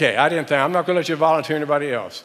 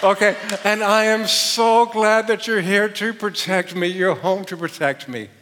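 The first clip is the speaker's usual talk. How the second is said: a little higher, louder clinging relief; clinging wife; 7 semitones higher, 6 dB louder than usual. Shown as a demonstration. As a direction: mildly play the relieved wife. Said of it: "repeat after me".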